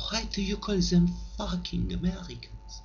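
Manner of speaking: a hint of a puzzled French girl; lightly voiced French girl.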